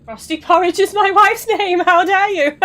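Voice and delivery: high, loud, indignant put-on voice; mock outraged shriek. Mock outrage over wife's name.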